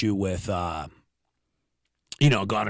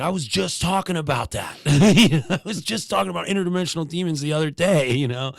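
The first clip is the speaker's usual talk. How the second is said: higher-pitched retold eagerness, breaking into a laugh; eager interjection; 6 semitones higher, 3 dB louder than usual. Comic retelling of jumping into the demon conversation, mock eager.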